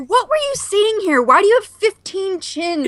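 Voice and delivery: slow, high mocking whine; mock a bewildered viewer. Mocking someone's awkward still frame.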